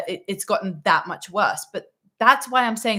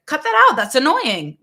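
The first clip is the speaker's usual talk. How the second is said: put-on much higher, louder snap at a child; irritated parent; 6 semitones higher, 8 dB louder than usual. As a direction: scold the kid for real annoyance. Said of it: "They're gonna be irritated".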